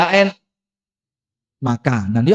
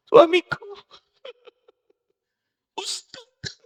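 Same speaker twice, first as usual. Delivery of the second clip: high, mock-tearful wail of a caller; weeping caller; 12 semitones higher, 7 dB quieter, slower than usual. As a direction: imitate a sobbing woman on the phone. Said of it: "Ngomong dhisik baru nangis". (menirukan ibu menangis di telepon)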